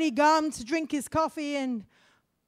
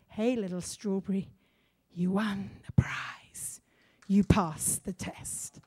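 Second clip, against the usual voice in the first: hushed storytelling; 6 semitones lower, 6 dB quieter than usual. Acted. Preacher quietly bringing the parable to its prize-giving climax. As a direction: draw them in with a hush.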